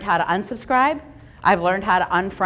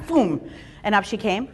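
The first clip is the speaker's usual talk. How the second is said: normal talking voice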